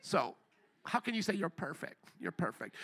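low, soft, smug put-down; stuck-up accuser. Retold or imitated. Coworker needling the believer about being perfect, mildly stuck up. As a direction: lightly mimic a stuck-up coworker's sneer. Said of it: "they kinda talk like this, a little stuck up".

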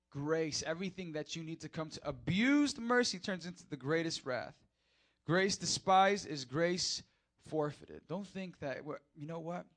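ordinary talk

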